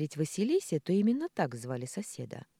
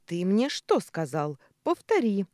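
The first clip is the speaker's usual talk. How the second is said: loud, insistent order, climbing higher; demanding; 6 semitones higher, 8 dB louder than usual. A narrator's reading. Angry boss to his worker Алим, demanding. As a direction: press hard for an answer. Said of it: "потребовал Николя".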